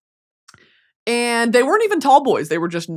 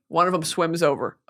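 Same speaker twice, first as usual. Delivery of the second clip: normal talking voice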